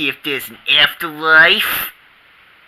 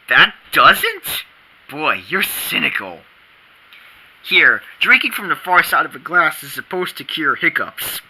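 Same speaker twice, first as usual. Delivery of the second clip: usual speaking voice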